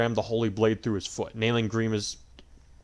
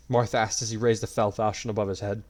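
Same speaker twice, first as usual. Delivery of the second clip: ordinary delivery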